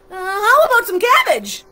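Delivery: high, loud, hurried offer; frantic townsperson. A narrator's reading. Townsperson offering cabbage to the raging monster, high and loud. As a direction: pipe up high with a desperate suggestion.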